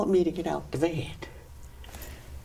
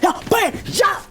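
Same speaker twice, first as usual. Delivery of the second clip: high, loud whoop; battle cry; 4 dB louder than usual. Retold or imitated. Reliving the fight for a friend, a loud victory whoop. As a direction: yell it like you're winning the fight.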